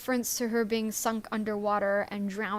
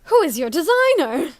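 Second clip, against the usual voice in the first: high, loud, swooping character voice; Charlotte's giddy question; 6 semitones higher, 9 dB louder than usual. Acted. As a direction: belt it out as a dramatic character quote. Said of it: "and then is like".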